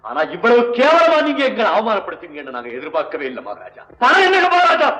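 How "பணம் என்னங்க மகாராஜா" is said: indignant outburst. Acted. Angry protest to the king.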